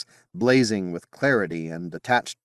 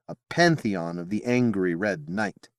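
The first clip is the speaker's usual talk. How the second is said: usual speaking voice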